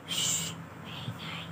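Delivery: soft unvoiced hush, slow; whispered shush. Real, not acted. Caregiver hushing the room so the baby sleeps, whispered.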